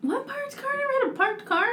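high, squeaky disbelief; incredulous denial. Real, not acted. Denying to a friend having hit a parked car, high and incredulous. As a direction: deny it with the voice way up.

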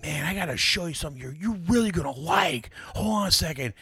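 hammed-up eager fan voice; eager kid. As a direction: gush like a kid with something to show. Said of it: "this kid comes up and goes".

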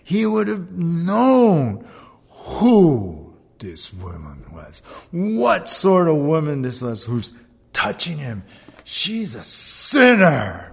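drawn-out, loud indignation; scandalized Pharisee. Acted. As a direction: stretch the key words in disbelief, punching them hard. Voicing the scandalized Pharisee's thoughts.

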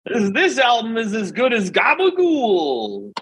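high, slow mock Jersey delivery; Jersey accent. Praising the album in a hammed-up slow Jersey accent. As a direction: ham it up like a Sopranos guy. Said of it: "New Jersey's like The Sopranos".

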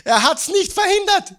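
high ringing shout; high loud proclamation. Real, not acted. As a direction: proclaim it to the whole room. Preacher answers his own question.

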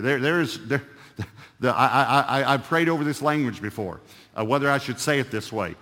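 normal talking voice

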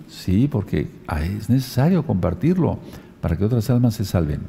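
ordinary delivery

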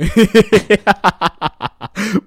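real high-pitched laugh; loud high laugh. Real, not acted. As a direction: burst of high shrieking laughter. Roasted after bad deep voice.

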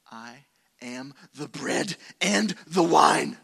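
Aslan's voice. Retold or imitated. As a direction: boom it out slowly like the great lion. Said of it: "had to be what Aslan sounded like".

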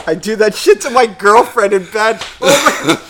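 high, loud helpless laughing delight; cracking up. Real, not acted. Host reading a listener's email, cracking up. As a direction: lose it while reading, voice shooting up.

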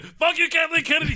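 high yelling cry; mock yell. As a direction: yell it defiantly at her. Mock outburst at Kathleen Kennedy.

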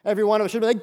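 ordinary delivery

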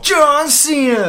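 high, slow ring announcer call. Acted. Cutting in on his friend's example, a drawn-out ring announcer call. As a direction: draw the name out like a ring announcer. Announcer-style shout of the wrestler's name.

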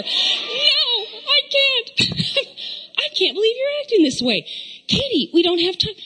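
put-on high, begging teen voice; pleading daughter. Mom imitating her daughter begging to be taken late. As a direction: beg over and over like a desperate kid.

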